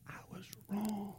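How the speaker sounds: soft unvoiced admission; a father's whispered admission to his son; whispered confession. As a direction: hush it as a confession. Quoting father's apology.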